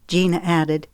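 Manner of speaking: ordinary delivery, nothing marked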